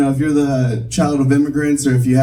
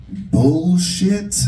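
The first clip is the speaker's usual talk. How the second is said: "bullshit" drawn out in an American accent, higher; 5 semitones higher than usual. Shown as a demonstration. Comic testing a word on the crowd, American-accented "bullshit". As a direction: stretch "bullshit" the American way, questioning. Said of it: "said with this American accent".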